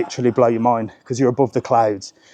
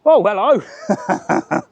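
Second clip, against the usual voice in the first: surprised greeting; 6 semitones higher, much slower than usual. Real, not acted. Surprised by passerby on hill.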